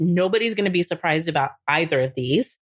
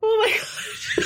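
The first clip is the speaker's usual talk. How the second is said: high-pitched laughing exclamation. Real, not acted. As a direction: laugh it out, caught off guard. Remembering a funny moment.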